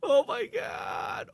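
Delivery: drawn-out, high, slow disbelieving cry; stunned outburst. Real, not acted. Streamer shocked by the remade Halo scene, slow high cry. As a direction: stretch it out in shock.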